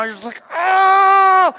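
loud high straining cry; dad grunting through a heavy lift; strained groan. Retold or imitated. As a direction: groan through the last rep. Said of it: "I'm loud".

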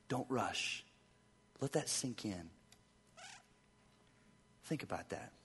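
soft, low hushed voice; hushed pause. Acted. Pastor urging listeners to pause and reflect, hushed. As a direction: let the words settle quietly.

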